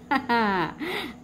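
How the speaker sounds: quick run of ha-ha; storyteller's giggle. Acted. Storyteller amused that mouse ate it all, giggling. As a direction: chuckle at the mouse's cleverness.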